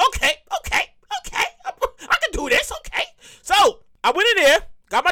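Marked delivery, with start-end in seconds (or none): 0.1-3.0 high, fast, shaky pep talk; nervous self-talk. Retold or imitated. Giving himself a pep talk at Popeyes, mock nervous. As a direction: talk yourself into it, half panicked.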